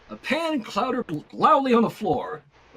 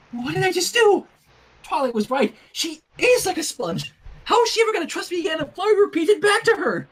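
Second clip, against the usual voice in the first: high, louder, fast worried babble; spiralling into panic; fast fretting voice; panicked thoughts; 8 semitones higher, 4 dB louder than usual. Acted.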